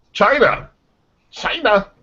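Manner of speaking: punchy high-pitched catchword impression; Donald Trump. Podcast host introducing a China news item, mock presidential delivery. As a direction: call it out high like a mimicked politician. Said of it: "Donald Trump likes to say".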